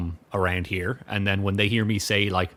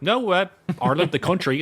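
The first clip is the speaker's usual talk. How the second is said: higher, insistent retold correction; 9 semitones higher than usual. Correcting the guy at work, retold insistence. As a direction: set him straight, pitched up.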